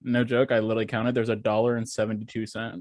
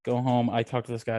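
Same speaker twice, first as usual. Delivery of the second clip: ordinary delivery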